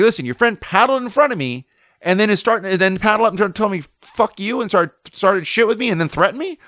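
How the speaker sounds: retold heated argument, pitched high; indignant complaint. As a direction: protest to the friend, building to disbelief. Acted argument with the kid's friend.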